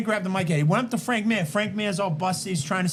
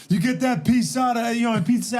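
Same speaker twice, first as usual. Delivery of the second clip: higher, louder promo shout that stumbles; Stone Cold impression; 6 semitones higher, 3 dB louder than usual. Botching the Austin promo for the guys, shouted. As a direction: bark the promo then fumble it. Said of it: "how he came out there and goes".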